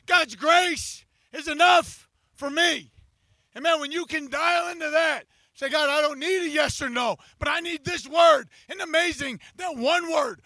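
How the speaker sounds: loud, driving sermon voice; preaching at full force. Acted. Pastor preaching to the church, full force. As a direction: preach it out to the whole beach.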